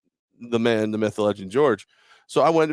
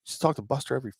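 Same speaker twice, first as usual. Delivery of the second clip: quick, quieter offhand shrug; offhand brag; 7 dB quieter, faster than usual. Retold or imitated. Host shrugging off fans' praise, offhand. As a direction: toss it off like it's nothing. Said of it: "I'm like".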